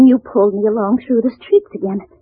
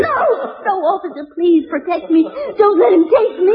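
high, desperate plea for protection; frightened begging; 6 semitones higher than usual. Acted. Wife being taken away by husband, desperate begging. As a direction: beg him in panic not to let go.

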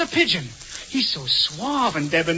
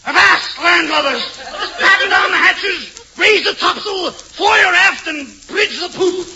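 booming pirate-captain bellow, higher and louder; sea captain; 7 semitones higher, 3 dB louder, slower than usual. Acted. To imaginary crew, a blustering sea captain bellowing orders. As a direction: shout orders across the deck like an old salt. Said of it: "makes me feel like a sea captain".

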